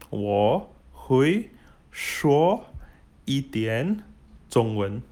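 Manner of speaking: slow, word by word, with gaps; slow model phrase. Shown as a demonstration. Teacher models a Mandarin sentence for beginners, slow and separated. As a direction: space out each word for learners to copy.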